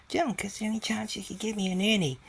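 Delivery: high, sing-song coaxing voice; sweet coaxing request. Retold or imitated. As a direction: coax a child sweetly, quickly. Asking spirit child for sound.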